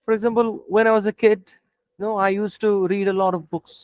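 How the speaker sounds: slow, crisp demo of the answer; slow, deliberate demo. Shown as a demonstration. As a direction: stress each word slowly like a model answer. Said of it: "আমার স্পিডটা একটু শুনেন".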